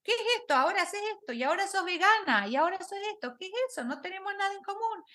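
imitating the husband's indignant protest; wife mimicking her husband's high indignant rant; exasperated husband. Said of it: "porque mi marido decía".

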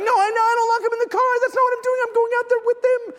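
high, loud, panicky self-defence; acted flustered dad; mock frantic protest. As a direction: plead innocence to onlookers, high-pitched. Dad protesting to staring shoppers.

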